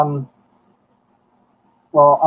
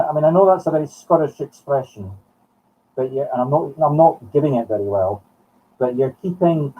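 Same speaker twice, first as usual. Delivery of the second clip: ordinary talk, nothing marked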